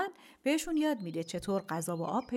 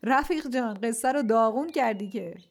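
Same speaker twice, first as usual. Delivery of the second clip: amused, laughing; 3 semitones higher, 5 dB louder than usual. A narrator's reading. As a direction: say it loudly, on the tail of a laugh. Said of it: "با صدای بلند خندید و گفت". (با خنده و صدای بلند)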